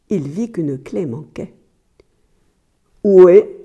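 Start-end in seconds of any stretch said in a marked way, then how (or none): 3.0-3.6 sudden loud demand; sharp demand. A narrator's reading. Husband discovering a key is missing, demanding loudly. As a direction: burst out at her.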